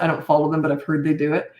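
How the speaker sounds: ordinary delivery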